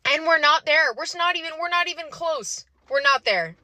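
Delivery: high-pitched, exasperated protest; upset insistence. Real, not acted. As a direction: burst out high, insisting in real frustration. Upset insistence that equality is unreached.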